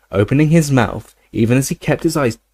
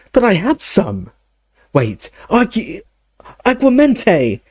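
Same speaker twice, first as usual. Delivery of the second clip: panicked; 10 semitones higher than usual. A narrator's reading. Harry panicking, recasting spell.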